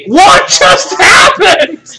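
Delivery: freed woman stunned, crying out; bewildered outcry. Acted. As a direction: shout it in total confusion.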